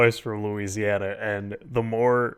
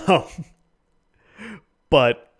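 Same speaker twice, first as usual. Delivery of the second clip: normal talking voice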